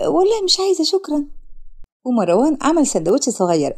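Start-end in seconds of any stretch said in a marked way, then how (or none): 0.1-1.3 lightly voiced shy girl. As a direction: play the shy tearful girl, timid. Sara voiced high. (بنت خجولة بصوت أعلى وأهدى)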